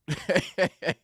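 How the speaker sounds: high, loud burst of laughter; real laugh. Real, not acted. Amused at calling the police, loud laughing. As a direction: burst out laughing mid-story.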